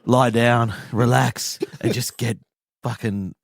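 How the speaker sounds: mock soothing host. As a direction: lull the old men into relaxing. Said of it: "I'm just gonna introduce this section".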